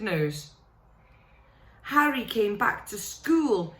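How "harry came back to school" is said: usual speaking voice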